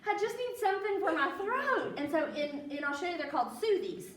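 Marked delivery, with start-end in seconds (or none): 0.1-1.9 high, pleading retold request. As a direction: plead for something for a sore throat. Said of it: "And I was like".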